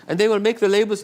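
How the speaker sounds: put-on high German-accented question. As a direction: imitate a puzzled German caller. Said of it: "I'll do my German accent".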